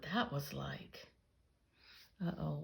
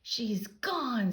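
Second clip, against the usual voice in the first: screaming; 5 semitones higher, 4 dB louder than usual. A narrator's reading. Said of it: "screamed the twins".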